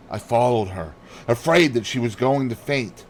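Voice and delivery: plain reading voice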